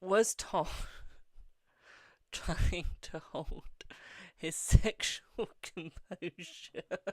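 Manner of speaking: words breaking up with real laughter; can't keep a straight face, slow and giggly; laughing through it. Real, not acted. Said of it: "This is torture".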